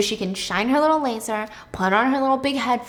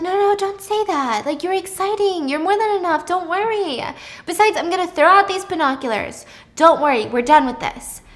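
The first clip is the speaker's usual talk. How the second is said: higher, lightly voiced reassurance; mock reassurance; 7 semitones higher, 3 dB louder than usual. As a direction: comfort him, a little high. Reassuring boyfriend.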